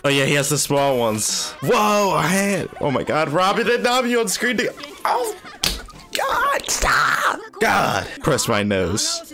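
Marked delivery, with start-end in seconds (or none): 1.6-5.4 giddy laugh through the words. Real, not acted. Fans over two characters together. 6.1-7.4 high-pitched squeal, breathy shout; excited shriek. Real, not acted. Overwhelmed by scene, shrieks.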